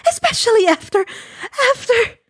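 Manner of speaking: high, hurt maternal scold. Acted. Inko scolding Giran for smoking, high hurt scold. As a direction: remind him of the broken promise, high. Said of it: "Inko scolded".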